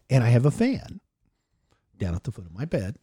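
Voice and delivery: normal talking voice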